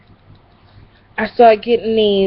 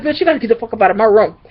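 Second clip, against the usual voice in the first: fast quote; much faster than usual. Retold or imitated. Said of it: "She was like".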